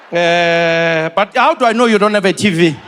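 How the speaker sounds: ordinary delivery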